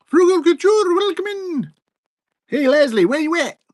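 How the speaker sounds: very high, bright mock welcome; high greeting voice. Streamer voicing a welcome button for Frugal Couture, high and mocking. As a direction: squeal out the welcome like a button sound. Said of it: "You really lost your accent?".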